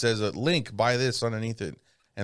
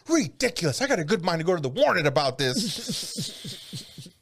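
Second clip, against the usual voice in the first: high-pitched imitated indignant scolding; hammed-up, high scolding voice; mock scolding; 6 semitones higher than usual. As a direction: play an affronted man telling someone off. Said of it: "He's scolding him and shit".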